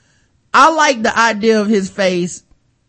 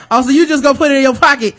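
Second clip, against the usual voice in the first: higher, louder, quick incredulous protest; mock mounting disbelief; 6 semitones higher, 5 dB louder, much faster than usual. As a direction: play growing alarm for laughs. Acting out Kraft's mounting disbelief.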